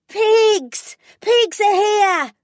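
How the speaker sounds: put-on high, loud child's scream. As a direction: shriek a warning with all her might. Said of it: "screamed with all her might".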